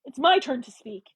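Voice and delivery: insistent angry command; forceful. A narrator's reading. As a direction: take the floor firmly. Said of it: "she said forcefully".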